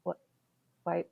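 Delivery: soft, halting questions with long gaps; hushed reproach. Retold or imitated. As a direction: quietly question a child, pausing between words. Calm scolding of her daughter, retold.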